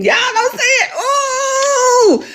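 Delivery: high, loud, joyful cry; real overjoyed shout with tears. Real, not acted. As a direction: squeal through happy tears. Overjoyed at gift.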